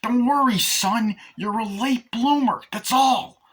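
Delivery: high, loud reassuring Chucky; Chucky's voice. Retold or imitated. Chucky reassuring Glenn, high and loud. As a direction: comfort the son, brashly. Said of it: "he's like".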